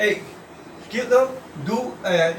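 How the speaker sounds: ordinary talk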